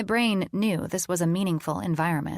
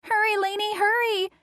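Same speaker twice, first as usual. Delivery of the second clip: put-on screaming child. John screaming for Addie's help. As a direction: yell it with playful panic.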